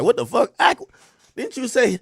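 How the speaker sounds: imitating a shocked critic, high and loud; mock outraged disbelief. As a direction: act out indignant viewers catching you out. Voicing critics' shocked reaction.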